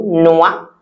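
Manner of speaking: noix said nwa, x silent. Shown as a demonstration. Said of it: "we don't pronounce it".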